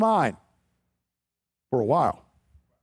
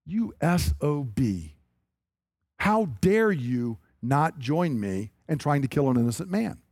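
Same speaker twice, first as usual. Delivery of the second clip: low menacing rebuke; 5 semitones lower than usual. Retold or imitated. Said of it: "Now to his son".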